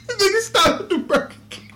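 high, laughing outrage; laughing disbelief. Real, not acted.

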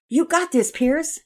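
loud, high cheer from the coach; encouraging shout. A narrator's reading. Coach to batter before the pitch, encouraging shout. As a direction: call out high to boost the batter.

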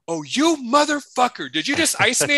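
high, mock-outraged sister; sister caught out. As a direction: pitch it up, playfully outraged at being iced.

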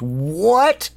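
slow, high, incredulous outburst; a monk losing his patience; snapping at him. Retold or imitated. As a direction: drag out the shock as you snap. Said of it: "and he snaps".

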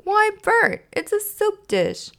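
very high, drawn-out surprise; high-pitched puzzled delight; polite surprise. Acted. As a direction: exclaim at an unexpected gift. Ernie opens a puzzling gift.

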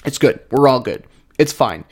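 talking through hiccups; fighting off hiccups mid-sentence; suppressing hiccups. Real, not acted. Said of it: "I have the hiccups".

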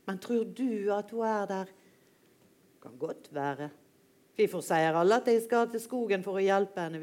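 reading a dialogue between two dogs aloud; read-aloud of a dog tale, lightly voiced characters; story reading with dialogue. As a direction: tell it like a fable for grown-ups.

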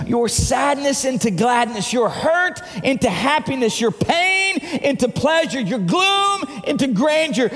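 high, rhythmic, forceful litany; ringing preacher's crescendo; exultant preaching. Real, not acted. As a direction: build each contrast like a celebration.

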